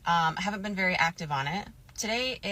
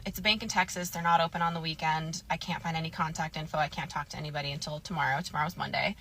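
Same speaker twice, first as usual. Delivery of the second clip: ordinary talk, nothing marked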